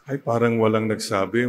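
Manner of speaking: ordinary talk, nothing marked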